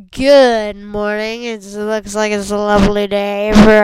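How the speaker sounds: loud morning show host; radio DJ voice. Pretend. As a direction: greet listeners like a DJ. Playing radio DJ.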